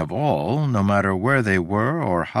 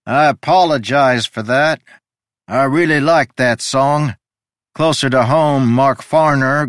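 higher, louder chatty host; genial strange host; 6 semitones higher, 6 dB louder than usual. Acted. Host in the library greets a visitor, chatty and genial. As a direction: call out amiably from across the room. Figure of the story speaks.

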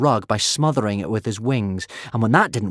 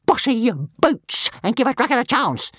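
high, louder whiny dragon; complaining; 13 semitones higher, 5 dB louder than usual. A narrator's reading. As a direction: whine it back at the boss.

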